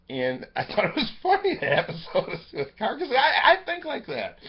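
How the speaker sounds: high-pitched, really amused laughter in the words; laughing while talking. Real, not acted. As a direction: crack up as you speak. Amused by a remembered episode.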